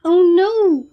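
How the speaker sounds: high, loud cry of alarm; panicked cry caught in the web; cried out. A narrator's reading. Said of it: "cried Krista".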